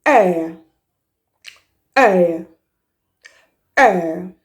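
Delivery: "Air" gliding from e to uh; air diphthong. Shown as a demonstration. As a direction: glide slowly through "Air". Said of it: "Another sound of the letter A is".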